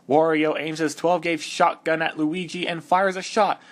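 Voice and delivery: ordinary delivery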